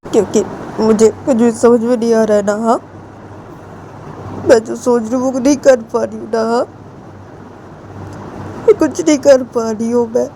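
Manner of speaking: high wailing confession through tears; crying Ritu. Acted. Ritu cries to Jiya confessing love, high wailing voice. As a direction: sob out how much you love her. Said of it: "रोते रोते ऐसे बोलती है".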